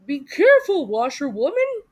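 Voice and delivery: loud warning to the driver; warning call. A narrator's reading. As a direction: call it out to stop her before she crashes.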